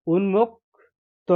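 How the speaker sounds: drawn-out teacher model; 1 slow spelled-out word. Shown as a demonstration. Teacher to pupils, উন্মুক্ত said very slowly. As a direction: stretch each sound of উন্মুক্ত slowly. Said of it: "teacher models spelling slowly".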